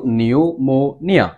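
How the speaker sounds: Pneumonia said slowly, divided into its syllables. Shown as a demonstration. Teacher showing students how to spell pneumonia, slowly syllable by syllable. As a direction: break pneumonia slowly into নিউ, মো, নিয়া. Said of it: "syllable ভাগ করে করে পড়বা".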